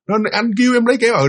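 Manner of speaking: ordinary delivery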